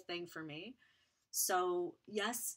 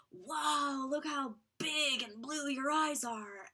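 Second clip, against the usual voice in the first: higher, louder, slow gushing wow; imitating strangers marveling at a child; amazed stranger; 7 semitones higher, 6 dB louder, much slower than usual. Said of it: "they'd point right in my face and go".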